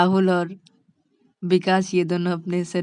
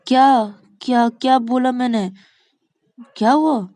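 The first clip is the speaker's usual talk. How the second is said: loud, raised questioning; taken aback; 6 semitones higher, 7 dB louder than usual. Acted. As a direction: demand what is going on, startled. Shreya startled, thinks it's aimed at her.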